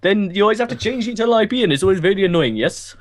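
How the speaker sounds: put-on high, hurried helpdesk accent; hammed-up tech-support patter, rising pitch; call-centre worker. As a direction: lecture the caller as a mock agent. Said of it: "welcome to the Virgin Media Call Center".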